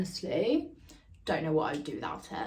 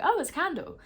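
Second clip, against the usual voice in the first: mock surprise; 10 semitones higher than usual. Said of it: "I was like".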